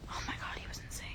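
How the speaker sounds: hushed, incredulous whisper; whispered "oh my God"; whispered disbelief. Real, not acted. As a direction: breathe it out in disbelief. Whispered reaction after story.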